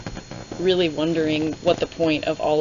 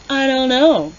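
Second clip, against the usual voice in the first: high, loud dismissive brush-off; flippant Celaena; 7 semitones higher, 7 dB louder than usual. Retold or imitated. Reviewer voicing Celaena, brushing off the prince's question. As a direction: toss the answer off carelessly. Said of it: "And she's like".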